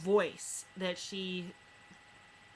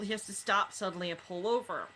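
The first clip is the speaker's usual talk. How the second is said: normal talking voice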